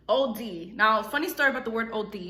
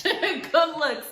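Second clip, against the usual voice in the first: laughing; 5 semitones higher, 3 dB louder than usual. Real, not acted. Laughs at herself.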